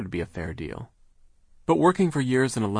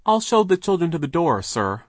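louder, higher, prompt answer; eager, quick offer; quick reply; 8 semitones higher, 7 dB louder than usual. A narrator's reading. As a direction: jump in obligingly. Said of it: "Charles said quickly".

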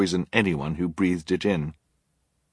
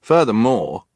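put-on louder, higher child's protest; firm objection; 5 semitones higher, 7 dB louder than usual. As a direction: cut in to add a point. Klaus adds to the protest.

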